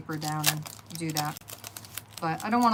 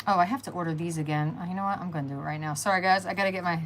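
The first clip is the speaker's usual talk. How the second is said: normal talking voice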